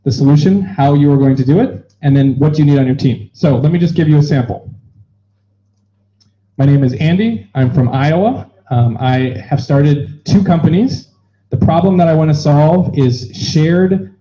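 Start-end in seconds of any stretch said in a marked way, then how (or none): none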